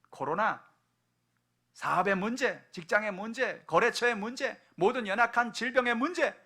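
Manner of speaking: climbing fervent list; building preaching. Real, not acted.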